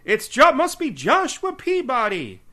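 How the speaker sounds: a flustered sheriff jumping to conclusions; Amos Tupper impression. Co-host repeating the sheriff's discovery, high and loud. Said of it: "my Amos Tupper impression".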